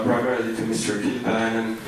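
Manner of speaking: ordinary talk, nothing marked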